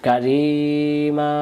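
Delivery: slow chanted Quranic recitation of কারিমা; drawn-out recitation. Shown as a demonstration. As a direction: stretch কারিমা long, sung like tajweed. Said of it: "সবসময় আজকে পড়তে থাকবেন".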